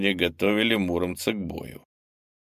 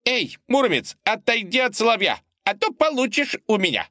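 put-on little girl shouting. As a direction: yell at the hero from the stands. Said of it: "неожиданно закричала".

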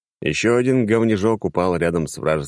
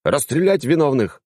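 gleeful yell; 9 semitones higher, 3 dB louder than usual. A narrator's reading. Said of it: "заорал я, веселясь".

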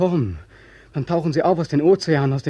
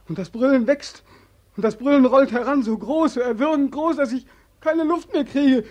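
mounting panic; 6 semitones higher than usual. Acted. Nightmare roar swelling, choking.

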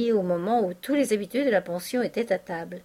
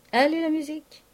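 higher, showman cue to the musicians; barker calling the band; 6 semitones higher than usual. A narrator's reading. Vautrin the mock quack calling for music, showman cry. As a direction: strike up the band like a fairground showman. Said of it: "l'accentuation d'un opérateur".

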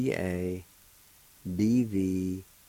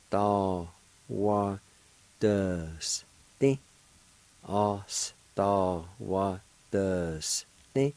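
Ostawadusty stretched out very slowly; very slow pronunciation; much slower than usual. Shown as a demonstration. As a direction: drag Ostawadusty out for the learner. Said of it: "it's pronounced like this".

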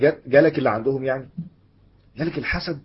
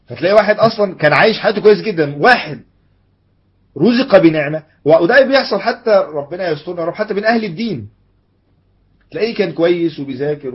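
raised, high sermon voice; emphatic preaching; 5 semitones higher, 9 dB louder than usual. Real, not acted. Preacher describing envy even among the religious, emphatic. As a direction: project each point loudly and insistently. Preacher builds an example with force.

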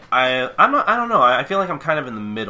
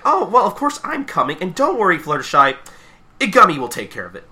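much higher, bubbly and eager; Pinkie Pie voice; 7 semitones higher, 4 dB louder than usual. Retold or imitated. Pinkie cheerfully reassuring Fluttershy, high bubbly voice. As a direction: chirp it out like a giddy party pony. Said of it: "she's like".

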